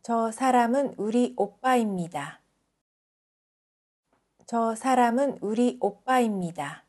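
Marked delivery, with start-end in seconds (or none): none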